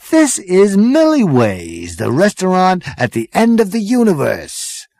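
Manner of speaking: high, slow, drawn-out thrill; excited Zaphod. Acted. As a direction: gush like a dazzled party boy. Zaphod character voice.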